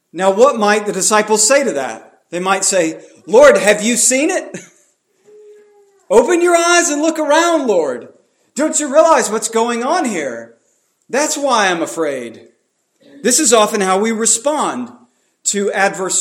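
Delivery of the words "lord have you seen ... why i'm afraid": disciples' protest. Acted. Disciples voiced pleading with Jesus.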